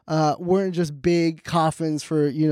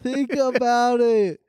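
high, very slow think-about-it; drawn-out mock insistence; 5 semitones higher, much slower than usual. Comic driving home his pun, slow mock insistence. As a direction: stretch every word, pitched up.

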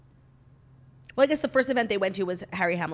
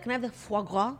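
overdone French accent; 4 dB quieter than usual. Shown as a demonstration. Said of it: "So I would never say".

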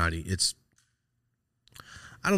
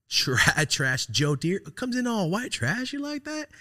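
laughing; 12 semitones higher, slower than usual. Real, not acted. Laughs while describing uncle's look.

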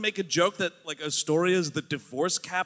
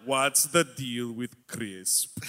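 slow, lightly voiced observational comic; stand-up comic voice; 6 semitones lower, 3 dB quieter, much slower than usual. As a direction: drag it out like a sitcom stand-up. Seinfeld parody right after.

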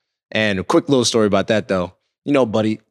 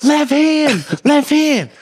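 much higher mock bench yell; 13 semitones higher, 4 dB louder, much slower than usual. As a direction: holler a warning to a teammate, imitated. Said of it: "And we're just yelling".

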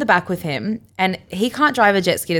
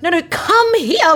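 high, slow beckoning; eager call; 10 semitones higher, 4 dB louder, slower than usual. Retold or imitated. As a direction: wave them over insistently. Waving guys down aggressively.

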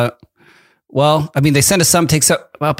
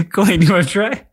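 ordinary delivery

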